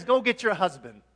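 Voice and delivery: loud, brisk command; Jesus. Acted. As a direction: call out the order firmly. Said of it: "He says".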